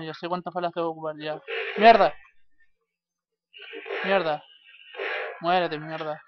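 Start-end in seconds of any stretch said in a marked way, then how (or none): 1.8-5.8 sudden loud curse; shout. Real, not acted. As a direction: blurt it out in frustration. Sudden frustration in gameplay.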